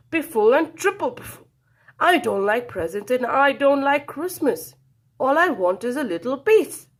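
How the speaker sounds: loud, high grumpy refusal; angry shout. A narrator's reading. Badger turns squirrel away, shouting. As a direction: bark him away from the door.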